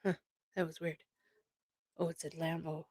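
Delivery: very soft, half to yourself; real quiet aside; distracted aside. Real, not acted. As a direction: drop far down in volume, off the conversation. Distracted by noise outside, aside.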